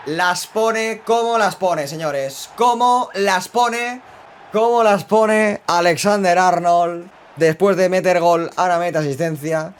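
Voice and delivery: high, drawn-out commentator voice; slow commentator praise. Acted. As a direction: savour each word like a broadcaster.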